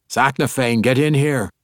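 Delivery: loud call across the room. A narrator's reading. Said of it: "Brunner called".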